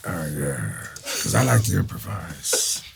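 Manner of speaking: slow, drawling impersonation of an actor; imitated star, unhurried and drawn out. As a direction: draw the words out, imitating a veteran actor. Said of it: "Al says to me right before".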